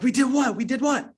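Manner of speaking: high, baffled double-take; surprised. Retold or imitated. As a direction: ask it back in disbelief. Confused reply to shouted news.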